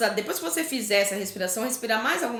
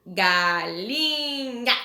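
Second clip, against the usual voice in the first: resposta arrastada, sílaba por sílaba; bem devagar, ditando; much slower than usual. Shown as a demonstration. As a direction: dite a resposta devagar para a criança. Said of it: "Responde lá". (ditado bem lento)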